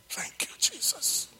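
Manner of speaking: high breathy whisper of thanks; a sudden whispered thank-you in the middle of speaking; whispered praise. Real, not acted. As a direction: breathe it to God. Whispered praise mid-testimony.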